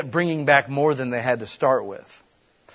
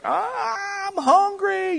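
put-on much higher whining child voice; whiny hungry kid; much slower than usual. Preacher imitating hungry crowd kids, high whine. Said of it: "whiny kids like".

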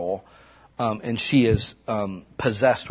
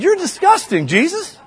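laughing rebuke; 6 dB louder than usual. Retold or imitated. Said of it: "I said".

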